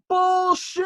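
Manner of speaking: high, loud heckling yell through cupped hands; yelled heckle. Retold or imitated. Friend heckles the singer from the crowd, loud yell. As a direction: bellow it at the stage like a heckler. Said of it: "As loud as you possibly could".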